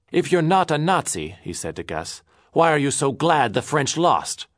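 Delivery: high, loud demanding challenge; angry challenge. Acted. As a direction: confront him hotly. Angry quarrel.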